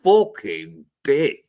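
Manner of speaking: slow, drawn-out sneering insult; mocking scold. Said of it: "Yeah, that's like".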